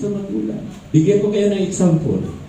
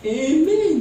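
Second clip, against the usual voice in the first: exclaimed amen; 12 semitones higher than usual. Real, not acted. Preacher calls for response.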